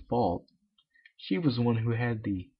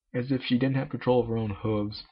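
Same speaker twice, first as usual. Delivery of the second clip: usual speaking voice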